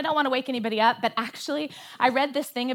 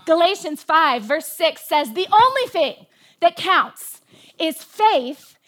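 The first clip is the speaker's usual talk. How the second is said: rising, louder preacher's exhortation; preaching; 5 semitones higher, 4 dB louder than usual. Acted. Preacher exhorting her congregation, fervent and loud. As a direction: drive the scripture home to the church.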